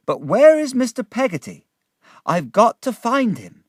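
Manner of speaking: high, loud, pressing woman's voice; urgent woman. Acted. Woman anxiously seeks a man.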